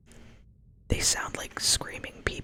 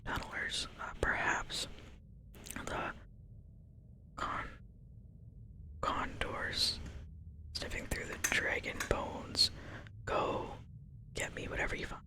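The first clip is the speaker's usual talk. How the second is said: softly whispering the quest text, lightly voicing the quest giver; whispered quest reading; 7 dB quieter than usual. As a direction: read the quest aloud in a hushed whisper. Reads quest giver's lines.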